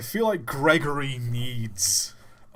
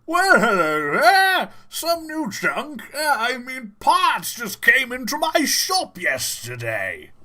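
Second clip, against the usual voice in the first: much higher, louder squeaky shopkeeper; Gregory voice; 10 semitones higher, 8 dB louder than usual. Acted. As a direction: squeak out the whole letter slowly. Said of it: "It's just".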